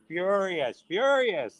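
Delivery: FURIOUS stretched out and pitched high; emphatic repetition. Real, not acted. Telling how retailers reacted, FURIOUS high and emphatic. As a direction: swoop up high on each FURIOUS.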